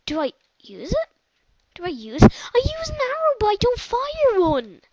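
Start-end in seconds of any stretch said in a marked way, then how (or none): 1.7-4.8 much higher, louder whine; frustrated gamer shout; frustrated cry. Real, not acted. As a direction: complain loudly at the game. Frustrated at arrow failing.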